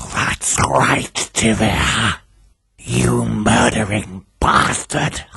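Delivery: harsh whispery hiss; strange hissing voice. A narrator's reading. Dead victims accusing their killer through Charles, hissing. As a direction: hiss like a possessing spirit. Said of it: "hissing voice from Charles".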